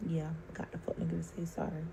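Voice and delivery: unvoiced gloating whisper under the breath; whispered aside. Real, not acted. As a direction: hiss it as a smug aside.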